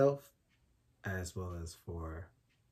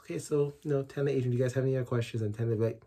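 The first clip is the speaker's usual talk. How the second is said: teasing voice; 6 semitones higher, 9 dB louder, faster than usual. Retold or imitated. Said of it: "would make fun of me at every appointment saying".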